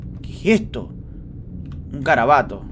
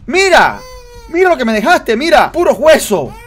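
loud, high hammed-up telling off; playfully angry parent at the baby; mock scolding; 6 semitones higher, 4 dB louder than usual. Scolding baby over eaten turkey.

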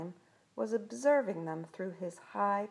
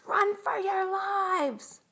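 high, loud, stretched warning shout; panicked cry; 12 semitones higher, 5 dB louder, slower than usual. A narrator's reading. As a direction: yell to friends to flee. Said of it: "cried Spud".

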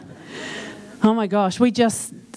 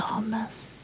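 hushed pupil; 13 dB quieter than usual. Retold or imitated. Voicing a pupil's hushed aside.

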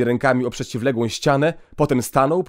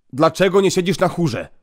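stern question; 6 semitones higher, 5 dB louder than usual. Acted. Priest interrogating the boy.

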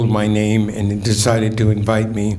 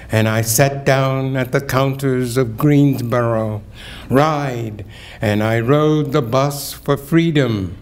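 solemn, building poetry recital; poem reading; 4 semitones higher than usual. Acted. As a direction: read it like a testimony. Reads a poem aloud.

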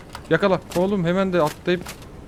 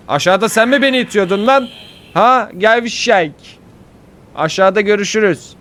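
high, loud mocking challenge; loud taunting; 7 semitones higher, 7 dB louder than usual. Real, not acted. Player shouting down at the enemy that pushed him, loud taunting.

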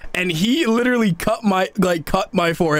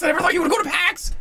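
high, shouted astonishment; excited amazement; 10 semitones higher, faster than usual. Real, not acted. Amazed that friend would go to PAX, excited shout. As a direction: blurt it high, happily amazed.

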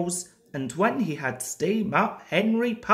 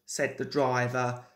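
plain reading voice

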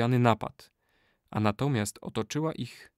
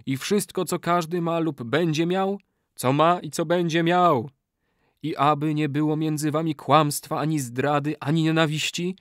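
raised-pitch solemn intoning; ritual incantation; 5 semitones higher than usual. A narrator's reading. Old man binds the new brothers by oath, intoning. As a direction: intone the oath for others to repeat.

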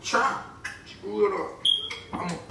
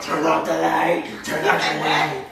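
imitating demon voice; 9 semitones lower, slower than usual. Friend mimics the possessed voice.

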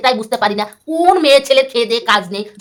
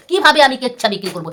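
ordinary talk

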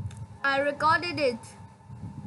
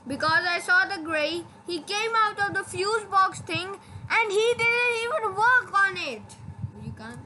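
usual speaking voice

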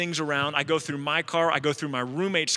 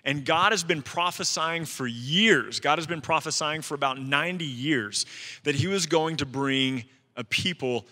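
sermon preaching; 3 semitones higher than usual. Acted. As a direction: preach it to the room with conviction. Preaching to congregation.

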